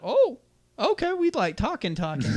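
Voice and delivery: very high, sing-song fake innocence; mock innocence. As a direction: play dumb, all sweetness. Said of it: "And I was like".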